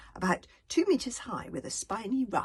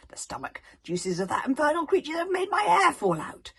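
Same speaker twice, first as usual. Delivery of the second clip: louder, higher savage rant; 10 semitones higher, 11 dB louder than usual. Acted. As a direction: spit out the bitterness. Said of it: "more and more savage".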